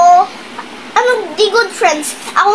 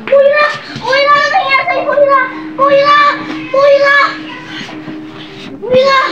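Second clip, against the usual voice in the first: frantic calling; 4 semitones higher than usual. Acted. Panicked calling to fainted friend.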